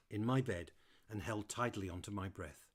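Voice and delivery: usual speaking voice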